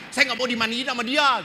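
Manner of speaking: high, loud complaining whine; mock fussy corpse. Priest joking about a picky corpse, high whine. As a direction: play a picky dead man refusing his coffin. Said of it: "gitu ya".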